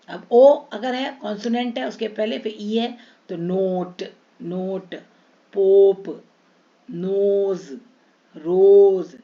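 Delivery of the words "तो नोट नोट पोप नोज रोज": long round o, one word at a time; stretched long o. Shown as a demonstration. Teacher drilling silent-e words, long o drawn out. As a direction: hold the ओ in "नोट", "पोप", "रोज". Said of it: "नो पे खींचेगा".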